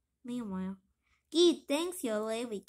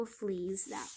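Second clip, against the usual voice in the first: whispering; 6 semitones lower, 5 dB quieter than usual. Acted. Whispered aside.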